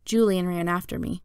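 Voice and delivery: ordinary delivery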